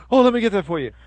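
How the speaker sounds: overly eager to please; mock fawning store manager. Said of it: "the manager runs over and goes".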